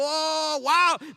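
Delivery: high, loud wow of amazement; a believer suddenly astonished; amazed exclamation. Acted. Preacher acts out amazed reaction.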